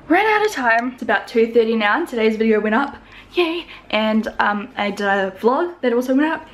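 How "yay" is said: high-pitched happy cheer; little cheer. Real, not acted. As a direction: celebrate with a lifted voice. Celebrates finished upload.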